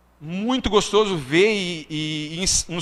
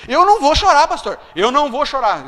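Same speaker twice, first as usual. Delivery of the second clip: louder, higher insistence on not crying; tearful insisting; 6 semitones higher, 5 dB louder than usual. Retold or imitated. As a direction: push back the tears with stubborn insistence. Retold refusing to cry.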